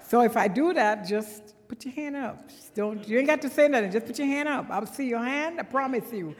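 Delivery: slipping into a Trinidadian lilt; playful Trinidadian accent. Shown as a demonstration. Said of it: "drop into my Trinidadian accent".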